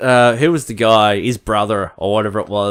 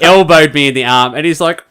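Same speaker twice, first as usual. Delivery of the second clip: ordinary delivery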